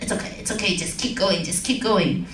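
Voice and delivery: calm them down, lightly voiced; easygoing host keeping things rolling; Howie Mandel, all cool. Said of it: "Howie Mandel's all cool".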